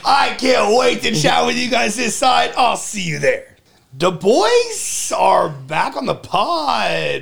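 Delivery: loud, high, slow hype-man shout; shouted hype intro. Acted. Host kicking off the show with co-host, shouted hype. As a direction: stretch the words out like an arena announcer.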